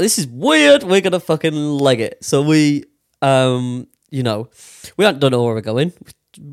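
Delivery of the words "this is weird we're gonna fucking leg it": high, louder panicked blurt; sudden alarm as they start running; alarmed exclamation. Retold or imitated. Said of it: "So we're like".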